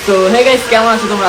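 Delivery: loud, upbeat hello to viewers; excited greeting. Real, not acted. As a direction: pump up the intro. Vlog intro greeting viewers.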